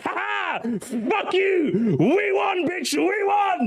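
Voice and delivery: high, taunting victory whoop; gloating JRPG fan. Retold or imitated. JRPG fan taunting everyone else, high gloating whoop. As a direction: crow it like a sore winner. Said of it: "J R P G guy, it's like".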